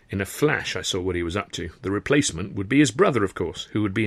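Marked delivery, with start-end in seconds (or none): none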